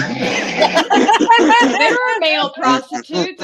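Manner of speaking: slow, loud, laughing words. Real, not acted. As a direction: laugh it out slowly. Laughing through joke.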